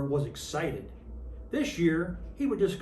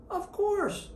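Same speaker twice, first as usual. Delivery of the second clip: much higher, chirpy elf chorus voice; elves replying; 13 semitones higher than usual. A narrator's reading. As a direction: answer brightly as a gang of tiny elves. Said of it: "the elves replied".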